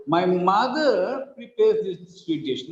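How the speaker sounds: slow, with "mother" stretched and emphasized; stress on MOTHER. Shown as a demonstration. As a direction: lean long on "mother". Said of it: "not father".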